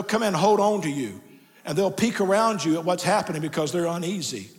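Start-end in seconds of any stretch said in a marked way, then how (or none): none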